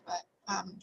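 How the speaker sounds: normal talking voice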